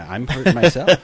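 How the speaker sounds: loud, laughing mock complaint. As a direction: play wounded while cracking up. Joins the joke, breaking into laughter.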